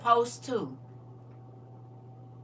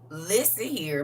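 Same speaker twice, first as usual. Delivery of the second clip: usual speaking voice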